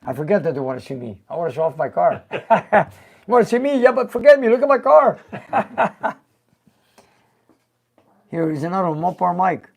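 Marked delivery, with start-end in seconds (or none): 2.3-5.2 high, loud, playful parody; lightly mock the eager fans. Nick mocks fans wanting to see him. 5.2-6.1 high laugh. Real, not acted. Laughs after his parody.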